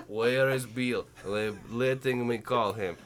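low, slowing Russian mother; fake Russian accent. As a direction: fret as a Russian mom in broken English. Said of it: "substitute that your mother is Russian".

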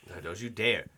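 ordinary talk

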